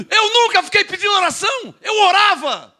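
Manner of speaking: high, forceful declaration; emphatic preaching. Real, not acted. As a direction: proclaim it with indignant conviction. Preacher emphatically rejecting begging.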